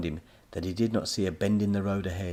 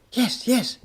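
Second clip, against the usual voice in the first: out of breath; 7 dB louder than usual. A narrator's reading. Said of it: "out of breath".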